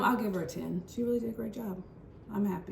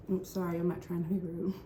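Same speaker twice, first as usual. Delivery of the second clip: ordinary talk, nothing marked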